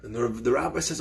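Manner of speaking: normal talking voice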